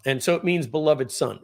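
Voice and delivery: ordinary talk, nothing marked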